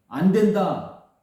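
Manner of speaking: slow, loud, stern refusal. Acted. Father Jacob forbids taking Benjamin, slow loud refusal. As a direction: clamp down on each word, forbidding it.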